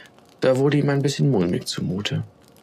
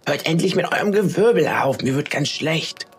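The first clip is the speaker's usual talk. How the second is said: higher, half-raised irritated voice; annoyed yell; 6 semitones higher than usual. A narrator's reading. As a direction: snap at the others without full shouting. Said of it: "Funkelchen brüllte die anderen Schneeflocken regelrecht an".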